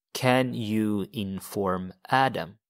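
"Can you inform Adam?" said slowly; slow prompt. Shown as a demonstration. Teacher slowly posing the sentence to translate. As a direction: set up the question slowly. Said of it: "how do you say".

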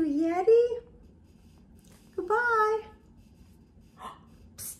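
normal talking voice

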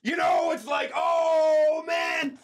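loud, high excited gamer shout; excited yelling. Real, not acted. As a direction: yell it out mid-match. Yelling during the game.

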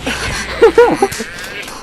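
high silly clown cackle; clown laugh. Pretend. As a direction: giggle like a clown. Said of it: "삐에로 성대모사 같은 게 나오죠".

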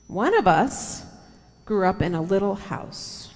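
gentle storybook reading with slow pauses; reading to children. Acted. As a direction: read it aloud like a picture book for the kids. Reading picture book to children.

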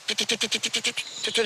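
quick, stuttered bird syllables; squabbling bird chatter. Shown as a demonstration. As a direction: imitate finches bickering in rapid repeats. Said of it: "kavga ederken aralarında tütü tabir ettiğimiz". (kavga eden saka taklidi)

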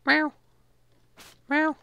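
usual speaking voice

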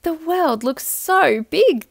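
put-on small, high, slow child's voice; a tiny acorn marvelling, drawn out; little acorn voice. A narrator's reading. Little acorn to his mother, high and slow. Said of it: "the little acorn said to his mother".

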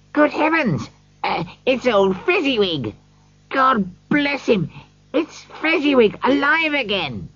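put-on overjoyed at seeing an old friend; excited. A narrator's reading. Scrooge seeing old Fezziwig, crying out excitedly. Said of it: "Scrooge cried excitedly".

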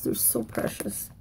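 a whiny, cooing gush. Real, not acted. Gushing to viewers over little girl stickers, whiny. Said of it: "I sound like all kind of whiny".

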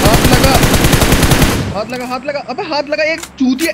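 high-pitched excited yelling; excited shout. Real, not acted. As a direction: scream it out in triumph. Excited kill callout in game.

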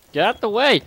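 high, loud yell to clear the path; urgent shout. Pretend. Gamer yelling at teammates to move, urgent shout. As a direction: yell it like you're under attack.

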